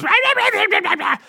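very high furious little rah rah rah; high squeaky gibberish tirade; angry hamster squeaks. Retold or imitated. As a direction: rant like a tiny enraged rodent. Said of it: "one of the voice lines, he just goes".

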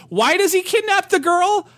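high-pitched, incredulous question; exasperated disbelief. Real, not acted. Reviewer baffled by a nonsensical kidnapping plot, exasperated disbelief.

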